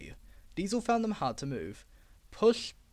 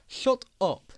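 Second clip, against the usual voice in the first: louder sharp command; stern order; 6 semitones higher, 6 dB louder than usual. Acted. Duck silencing the rude trucks, stern order. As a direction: order them to stop. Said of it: "he ordered".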